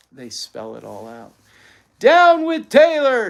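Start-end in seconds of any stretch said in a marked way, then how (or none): 2.0-3.3 slow, very high, very loud jeer; rival shout. A narrator's reading. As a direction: boo the other school at full volume. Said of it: "shouted another carload".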